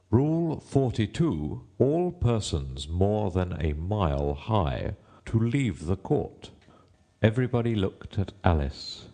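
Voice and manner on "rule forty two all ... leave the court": slow, drawn-out royal proclamation; reading out a rule. A narrator's reading. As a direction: read the rule out slowly as a king. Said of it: "read out from his book".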